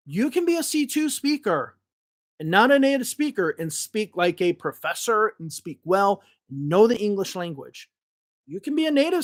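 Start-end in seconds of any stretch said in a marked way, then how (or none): none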